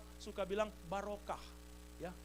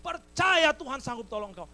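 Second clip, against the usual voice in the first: high, loud, emphatic reply; eager shouted answer; 6 semitones higher, 14 dB louder than usual. Acted. Hanging man answers God that he believes, loud and eager. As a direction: shout your belief straight back.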